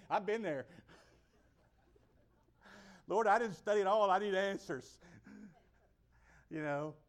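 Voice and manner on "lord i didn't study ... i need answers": loud, high mock plea; hammed-up exam prayer; desperate praying kid. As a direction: confess to the Lord in comic panic.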